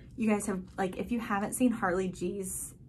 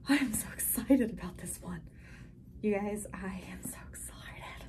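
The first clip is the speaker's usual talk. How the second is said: whispering; 4 dB quieter than usual. Real, not acted. Excited whisper about new leaf.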